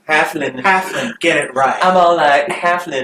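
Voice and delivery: loud, high mocking show-off; Saren voice. Player mocking companion's vanity, loud put-on voice. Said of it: "that's my Saren voice".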